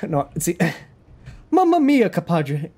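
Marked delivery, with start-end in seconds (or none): 1.5-2.7 high-pitched, punchy mock Italian; hammy Italian accent. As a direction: exclaim it as a cartoon Italian. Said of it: "So Italian".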